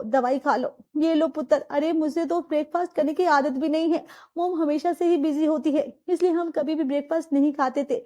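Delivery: usual speaking voice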